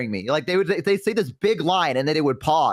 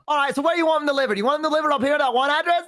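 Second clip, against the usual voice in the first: high, rapid-fire imitation; fast-talking Australian clerk; 13 semitones higher, much faster than usual. Flower shop clerk asking about delivery, imitated rapid talk. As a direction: race through it in a much higher voice. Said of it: "why are they talking so fast?".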